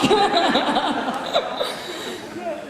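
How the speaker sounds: high creaky laugh; fry laugh. Shown as a demonstration. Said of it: "That is vocal fry".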